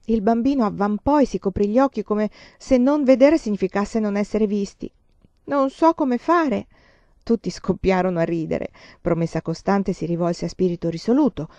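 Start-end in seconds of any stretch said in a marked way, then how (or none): 5.5-6.7 slow, high child's voice, lightly acted; embarrassed child. A narrator's reading. Child hides his eyes, confessing slowly in embarrassment. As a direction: admit it slowly in a raised little voice.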